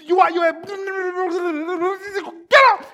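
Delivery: high-pitched scolding rant, bursting out at the end; hammed-up angry telling-off; furious older brother. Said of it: "He was so angry at him".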